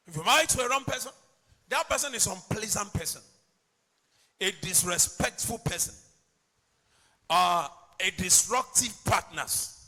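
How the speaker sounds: loud, emphatic sermon delivery; preaching at full force. Acted. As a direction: preach it out loud, stretching key words. Preacher building up his points.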